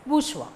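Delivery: "bourgeois" said as "boosh-wa"; pronunciation model. Shown as a demonstration. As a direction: say "bourgeois" as two syllables, silent final s. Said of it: "should be pronounced as".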